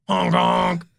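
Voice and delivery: mock truck horn; silly honk.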